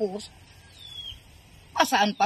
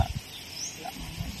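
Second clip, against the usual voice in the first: retold whisper; 13 dB quieter, slower than usual. Daughter's answer retold, whispered.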